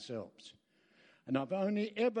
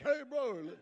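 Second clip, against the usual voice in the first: higher, laughing "hey bro"; friendly mate greeting; 8 semitones higher than usual. Retold or imitated. Young men turned friendly, greeting him with a laugh. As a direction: play the young blokes now all friendly.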